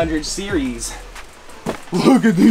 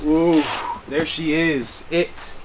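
ordinary talk, nothing marked

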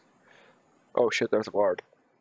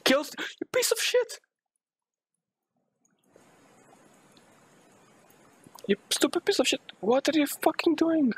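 high-pitched exasperated yell at a game; real gamer outburst; angry yelling. Real, not acted. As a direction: yell it high, outraged at the blunder.

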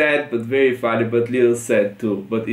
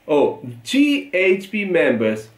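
usual speaking voice

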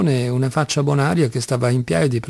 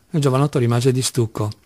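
plain reading voice

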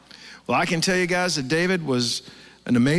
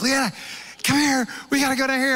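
higher, urgent call to the wife; excited call; 8 semitones higher than usual. Retold or imitated. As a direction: call her over, can't wait. Calling his wife excitedly.

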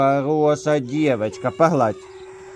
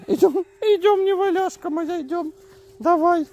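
high coaxing. Real, not acted. Coaxing toddler to walk.

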